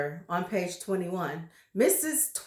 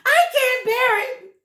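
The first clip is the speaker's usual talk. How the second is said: much higher, louder anguished cry; 10 dB louder than usual. Acted. Terrified wife wailing, high loud cry. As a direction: wail in despair. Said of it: "cried Missus Twit".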